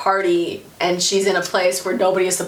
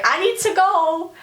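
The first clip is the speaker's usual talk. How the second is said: high acted confusion; high-pitched character quote; mock flustered; 8 semitones higher than usual. Friend voiced, confused and leaving.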